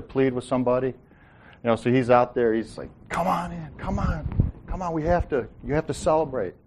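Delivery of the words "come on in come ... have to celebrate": pleading. Retold or imitated. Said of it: "he's pleading with him".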